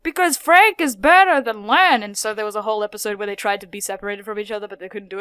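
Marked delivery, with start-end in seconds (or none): 0.0-2.0 loud, high, swooping mock boast; hammed-up insistent answer; acted cartoon retort. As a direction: imitate a character bragging to their twin. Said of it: "this motherfucker answered with".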